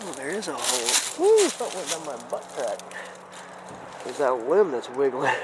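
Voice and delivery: ordinary delivery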